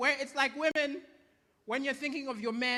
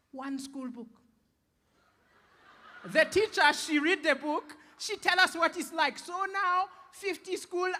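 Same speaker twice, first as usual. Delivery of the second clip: put-on uncle's Malawian accent; 2 semitones higher than usual. Uncle explains proudly, accent.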